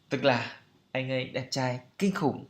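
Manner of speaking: ordinary delivery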